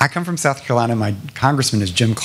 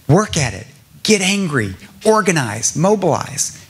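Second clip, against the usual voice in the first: rousing call; 6 semitones higher than usual. Real, not acted. Rallying call to the audience.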